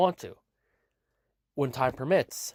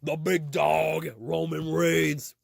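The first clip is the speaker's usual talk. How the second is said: slow, drawn-out wrestling intro; mock ring announcer; much slower than usual. Announcing a wrestler to the crowd, drawn-out announcer call. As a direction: stretch each word like a ring announcer.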